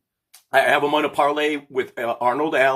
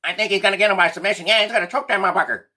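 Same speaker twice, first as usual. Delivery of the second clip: high, fast put-on voice; Bear voice; 7 semitones higher, much faster than usual. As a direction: rattle it off quick in a higher goofy voice. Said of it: "I wanna do the Bear voice".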